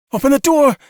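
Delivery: high, loud panicked shriek. A narrator's reading. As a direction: scream it at the top of your lungs. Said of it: "Cassie shrieked".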